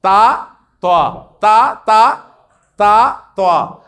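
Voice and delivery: تا light and flat, توا rounded and heavy; ta versus toa contrast. Shown as a demonstration. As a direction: alternate a plain تا with a rounded توا. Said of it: "تھوڑا سا ذرا گول کر دینا".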